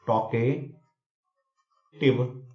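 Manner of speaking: Talkative drawn out slowly and softly; slow teacher's model of talkative; slow clear demo. Shown as a demonstration. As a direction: let talkative hang, very slow. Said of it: "लोक्वेशियस means क्या".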